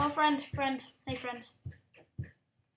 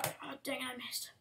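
ordinary talk

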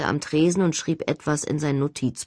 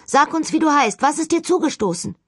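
raised, higher call into the dark; calling to an unseen ghost, raised voice; 10 semitones higher, 3 dB louder than usual. Acted.

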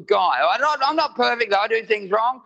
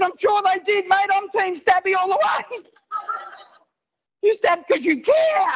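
high-pitched, over-eager cheering on; mock support; 13 semitones higher than usual. Comic telling the stabber he's on his team, mock support. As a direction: back him up in a high, stretched voice. Acted placating of a confessed stabber.

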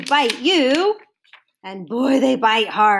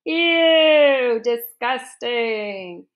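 disgusted; much slower than usual. Real, not acted. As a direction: recoil in playful disgust. Reader reacts to gross picture.

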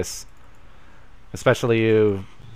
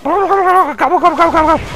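high gobbling noise; 7 dB louder than usual. Acted.

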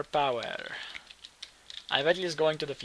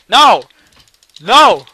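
much higher, much louder cry of no; desperate yell; 7 semitones higher, 23 dB louder than usual. Real, not acted. Gamer losing to his rival, desperate yelling. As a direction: scream in despair.

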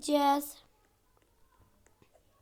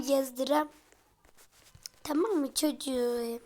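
ordinary talk

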